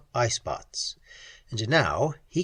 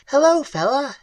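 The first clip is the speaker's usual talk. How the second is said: much higher, louder alien echo; clicking high creature voice; 13 semitones higher, 6 dB louder than usual. A narrator's reading. Creature echoes Pat's greeting back, clicking and high. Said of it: "clicking, high-pitched voice".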